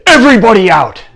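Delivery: high, loud royal order; shouted dismissal. Acted. Excited king sending everyone away, urgent shout. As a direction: clear the room with one shout.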